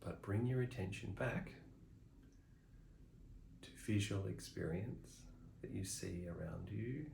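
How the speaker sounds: soft, unhurried meditation guide with long pauses; softly spoken meditation instructions, spaced well apart; guided meditation. Real, not acted. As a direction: gently talk them through the practice, leaving long silences.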